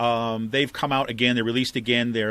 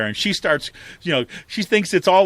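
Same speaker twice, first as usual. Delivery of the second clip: usual speaking voice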